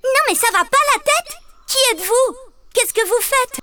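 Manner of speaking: put-on high little girl voice, outraged surprise; astonished little girl. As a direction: scold the ogres in disbelief. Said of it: "stupéfaite et leur demande". (petite fille stupéfaite, voix très aiguë)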